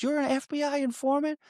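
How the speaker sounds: high, strained disbelief; a hammed-up Jack Nicholson sneer; incredulous dying mobster. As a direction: squeeze it out like a dying gangster in shock. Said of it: "He goes".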